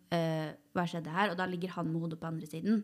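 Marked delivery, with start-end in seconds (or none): 0.1-1.3 slow, lower, half-asleep question; slow sleepy bewilderment; groggy confusion. Retold or imitated. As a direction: drag it out like just waking up. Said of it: "Og var sånn".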